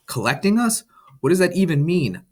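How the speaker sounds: high, startled questions; surprised. A narrator's reading. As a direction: ask in wide-eyed surprise. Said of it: "I asked as my eyes were wide".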